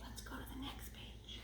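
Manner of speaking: soft aside whisper. Real, not acted. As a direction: whisper it off-mic.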